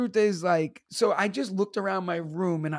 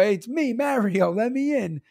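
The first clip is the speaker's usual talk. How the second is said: higher-pitched demand to be let in; caller at the door; 5 semitones higher than usual. Retold or imitated. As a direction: call through a door as you bang on it. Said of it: "like he's banging on your door".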